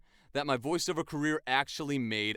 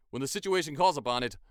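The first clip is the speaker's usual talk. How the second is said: ordinary talk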